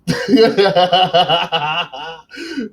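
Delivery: stiff, evenly pulsed mock laugh, pitched high; high, mechanical staccato cackle; robot laugh. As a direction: laugh like a robot, ha-ha-ha in a steady rhythm. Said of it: "trying to do a robot thing".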